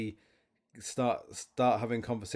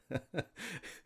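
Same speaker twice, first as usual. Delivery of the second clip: laugh; 8 semitones higher, 8 dB quieter than usual. Real, not acted. Laughing at own joke.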